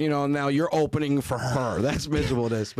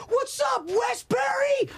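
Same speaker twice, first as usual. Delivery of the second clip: high-pitched shouted stage greeting; hyping a crowd; much slower than usual. Retold or imitated. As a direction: yell it like a kid working a big crowd. Daughter greets the crowd on mic.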